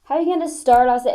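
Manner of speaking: loud, fast angry outburst; angry shout. Real, not acted. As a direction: yell it in disbelief. Reacting furiously to the clip.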